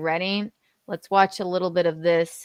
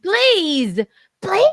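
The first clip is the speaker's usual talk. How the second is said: excited plea; 13 semitones higher, 8 dB louder than usual. Shown as a demonstration. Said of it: "I wanted to be more like that".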